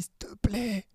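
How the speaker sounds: whispered "please"; pleading whisper. Retold or imitated. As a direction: beg him under your breath. President begging for one match.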